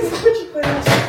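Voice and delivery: high, loud, laughing question; laughing protest. Real, not acted. Amused by partner's fake accent.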